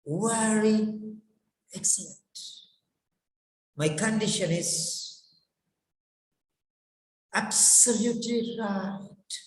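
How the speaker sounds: breathy soft "Yes", then separated "I'm", "very", "excellent"; expressive answer. Shown as a demonstration. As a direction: pause between words, stretching "absolutely". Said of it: "you have to express your feelings".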